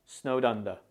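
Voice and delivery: SNOWED UNDER linked, with a light D; subtle D. Shown as a demonstration. Teacher leads learners repeating it, subtle linked D. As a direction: say SNOWED UNDER as one unit, D not too strong. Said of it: "Say it with me now".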